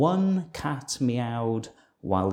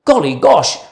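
loud, high exclamation; startled farmer; 6 semitones higher, 11 dB louder than usual. A narrator's reading. Farmer woken by the splash, loud surprised cry. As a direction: wake up with a start. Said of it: "the farmer woke and said".

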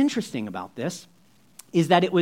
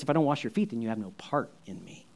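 soft reply, falling to very low and hushed; quiet firm answer; 6 semitones lower, 9 dB quieter, faster than usual. Retold or imitated. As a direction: answer the outburst softly. Jesus answers Peter.

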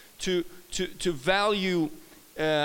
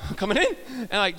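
usual speaking voice

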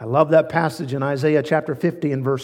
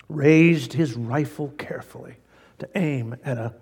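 ordinary talk, nothing marked

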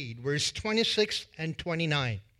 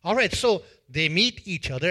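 ordinary delivery

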